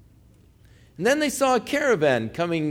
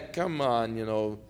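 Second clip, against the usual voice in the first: low, softer dismissive taunt; contemptuous brush-off; 7 semitones lower, 7 dB quieter than usual. Retold or imitated. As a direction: shrug him off with quiet scorn. Brothers mock Joseph being led off.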